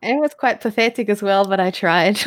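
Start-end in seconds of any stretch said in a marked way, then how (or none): 1.7-2.3 laughing. Real, not acted. Laughing at own failed accent.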